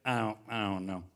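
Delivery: low, halting, dopey reply; clueless drunk buddy. Retold or imitated. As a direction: sink low as if utterly befuddled. Imitating drunk, clueless buddy again.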